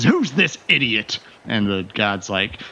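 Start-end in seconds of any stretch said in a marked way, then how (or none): 0.0-1.2 a touch of high barking anger; angry captain. Acted. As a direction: snap it at the guard. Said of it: "splutteringly angry".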